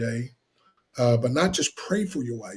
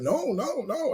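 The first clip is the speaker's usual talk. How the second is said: high, soft backpedalling; retold hasty reassurance; 10 semitones higher, 6 dB quieter, slower than usual. Husband retelling how he reassured his wife, high soft reassurance. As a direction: quickly soothe her in a raised, light pitch.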